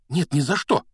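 high, loud, alarmed no; emphatic high-pitched protest; exclaimed refusal. A narrator's reading. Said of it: "воскликнула та".

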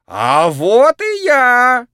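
booming, drawn-out showman cry; loud clown shout. A narrator's reading. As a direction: shout it to the whole arena. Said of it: "громко крикнул хозяин".

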